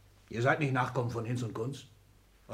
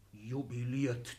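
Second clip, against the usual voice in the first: ordinary delivery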